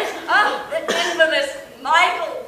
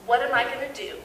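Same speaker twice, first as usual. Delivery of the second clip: normal talking voice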